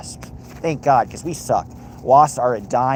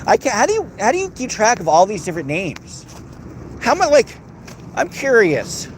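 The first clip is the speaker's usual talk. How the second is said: exasperated disbelief; 9 semitones higher than usual. Real, not acted. Bewildered by names.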